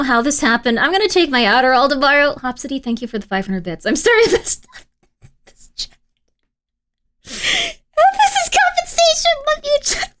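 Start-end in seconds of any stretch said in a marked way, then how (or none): 3.9-6.0 giggly apology. Real, not acted. 7.2-10.1 very high, slow, squeaky cartoon voice; squeaky silly voice. Streamer making amends to chat in a squeaky high voice.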